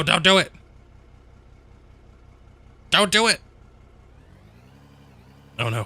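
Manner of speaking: usual speaking voice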